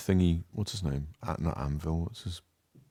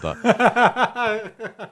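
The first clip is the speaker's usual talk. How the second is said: high, slow, breaking into laughter; laughing; 8 dB louder than usual. Real, not acted. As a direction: laugh through the regret. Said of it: "I'm gonna do a Chinese accent".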